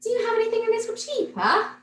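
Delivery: put-on high, quick little girl asking hopefully; a child's bright, hurried question; Millie's voice. A narrator's reading. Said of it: "she said".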